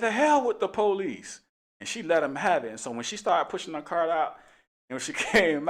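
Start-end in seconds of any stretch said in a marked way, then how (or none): 0.0-1.4 slower, higher mock defiance; mock defiant retort. Retelling the lady's words to the officer, slow mock defiance. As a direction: drag it out, imitating her scorn.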